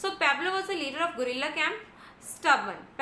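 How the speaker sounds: normal talking voice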